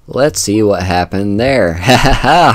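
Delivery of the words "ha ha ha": amused laugh. Real, not acted. Watching teammate die on replay.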